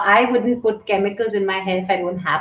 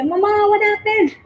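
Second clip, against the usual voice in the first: put-on high little-kid voice, far higher than her own; child asking; 11 semitones higher than usual. Mother imitating her kids asking in a high child's voice. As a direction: mimic a small child asking her mother what happened. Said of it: "So the kids can say".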